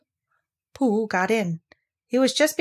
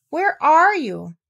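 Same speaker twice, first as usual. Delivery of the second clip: high, slow, louder call; 9 semitones higher, 6 dB louder, much slower than usual. A narrator's reading. Christopher Robin calling for missing Pooh, high and drawn out. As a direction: call across the water.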